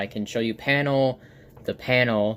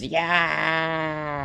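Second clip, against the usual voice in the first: long higher-pitched mock scream; drawn-out cartoon yell; 5 semitones higher than usual. Flowey barreling down the mountain, long cartoon yell. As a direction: hold the vowel all the way down. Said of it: "and he goes".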